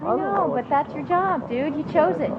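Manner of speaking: high, fast, exasperated retort; heated. Real, not acted. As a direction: push back hard, fed up. Heated argument with the caregiver.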